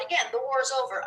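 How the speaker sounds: ordinary talk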